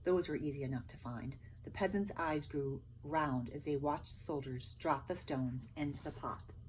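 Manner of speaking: ordinary delivery, nothing marked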